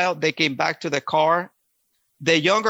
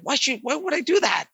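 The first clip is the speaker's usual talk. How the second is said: incredulous officer; 9 semitones higher than usual. Retold or imitated. Officer reassuring frightened prisoner.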